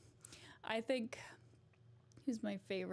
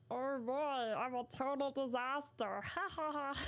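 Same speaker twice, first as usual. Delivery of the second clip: squawky duck voice, laughing at the end; Donald Duck impression; 3 semitones higher than usual. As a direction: quack it out like a cartoon duck. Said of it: "Donald Duck".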